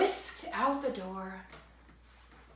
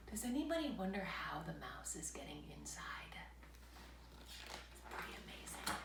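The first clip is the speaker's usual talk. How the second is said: whisper; 3 semitones lower, 13 dB quieter than usual. Real, not acted. Whispered asides to child.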